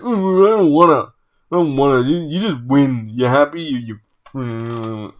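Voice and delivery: put-on whiny big-toddler tantrum, petering out; hammed-up petulant grumbling; mock sulking. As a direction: imitate a sore loser refusing to play. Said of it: "was a big sulky toddler".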